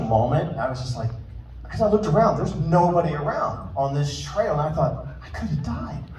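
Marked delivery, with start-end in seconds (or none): 5.2-6.0 hushed awe. Retold or imitated. Awed realization.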